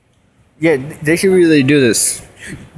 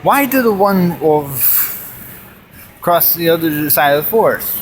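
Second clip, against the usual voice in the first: usual speaking voice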